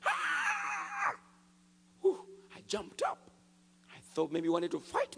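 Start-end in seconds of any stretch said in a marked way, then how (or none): 0.0-1.1 very high piercing shriek; demon's scream. Retold or imitated. Possessed man screams out on the floor, piercing shriek. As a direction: let out a wild possessed shriek. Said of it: "screamed out with a loud voice".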